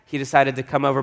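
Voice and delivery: usual speaking voice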